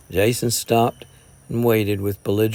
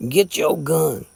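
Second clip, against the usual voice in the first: slow, pressing mild fury; furious repeat; 5 semitones higher, slower than usual. A narrator's reading. Jason repeats his challenge to Gray, slow mild fury. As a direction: hammer the demand again. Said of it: "was the furious answer".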